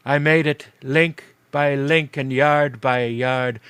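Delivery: slow, loud, heavy spirit voice; solemn ghost. Acted. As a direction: weigh each link like a doomed ghost.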